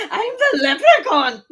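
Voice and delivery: laughing, high leprechaun squeak; laughing mock leprechaun. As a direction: play a giggling leprechaun. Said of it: "like a broken leprechaun".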